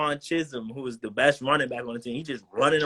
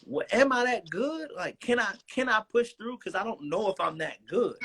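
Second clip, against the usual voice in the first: self-doubt; 8 semitones higher than usual. Retold or imitated. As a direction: voice your own doubting thoughts. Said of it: "I was questioning".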